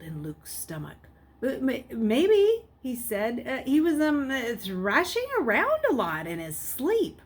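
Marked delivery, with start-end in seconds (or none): 1.4-2.6 halting, loud and slow with panic; panicked boy stammering; nervous stammer. Acted. Luke panicking, stammering to nurse. 3.5-7.2 higher, louder, hesitant fib; boy nervously lying to the nurse; nervous lie. Acted.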